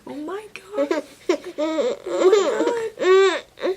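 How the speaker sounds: high, drawn-out mock squeal of awe; retold breathless wonder; awed gushing. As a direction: gush over a newborn, high and slow. Marvelling at her newborn, retold.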